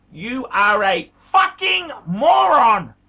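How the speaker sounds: slow, loud yelled insult; angry shout. Real, not acted. Berating George for wanting new dial, yelling. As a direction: hammer out each word in fury.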